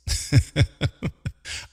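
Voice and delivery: low, rolling chuckle; self-amused chuckle. Real, not acted. Host got lost on the S, self-amused chuckle. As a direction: laugh at your own slip. Laughs at own slip.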